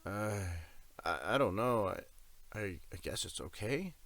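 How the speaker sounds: shaky and unsure, stumbling over I. A narrator's reading. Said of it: "Tim's answer was with a very shaky voice".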